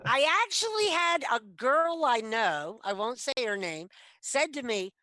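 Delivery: ordinary talk, nothing marked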